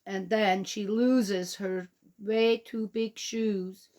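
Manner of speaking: ordinary delivery, nothing marked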